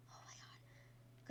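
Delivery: whisper. Real, not acted. Near miss in race.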